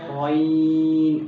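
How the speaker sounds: غین loud, round-mouthed and drawn out slowly; slow recited غین; long rounded ghain. Shown as a demonstration. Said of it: "منہ کی گلائی آئے گی".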